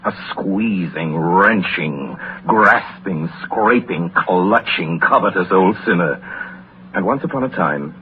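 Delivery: low, soft storytelling voice, unhurried and grave; story narration. A narrator's reading. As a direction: draw the listener into an old tale by the fire. Welles reads Dickens story narration.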